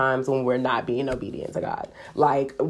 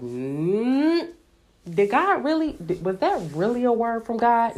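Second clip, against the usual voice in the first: higher, skeptical second-guessing; mock doubt; 7 semitones higher than usual. Believer doubting a word from God, mock skeptical. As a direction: question it like you can't quite believe.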